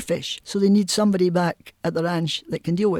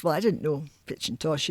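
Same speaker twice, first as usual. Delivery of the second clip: usual speaking voice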